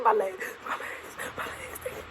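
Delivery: reliving panic over numb legs; frightened wail trailing into a whisper; panicked. Retold or imitated. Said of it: "I said".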